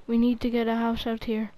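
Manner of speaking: ordinary delivery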